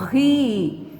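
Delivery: "Ri" alone, held out as one syllable; sílaba isolada. Shown as a demonstration. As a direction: isolate "Ri" with a drawn-out strong R. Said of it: "em que posição da sílaba está o R". ("Ri" destacado, sílaba sozinha)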